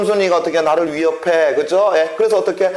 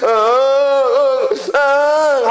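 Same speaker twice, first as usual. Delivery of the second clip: high, slow hammed-up sobs; the boy breaking down crying in front of Jeomsun; mock sobbing; 10 semitones higher, much slower than usual. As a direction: wail like a boy bursting into tears. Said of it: "울음을 놓았더라".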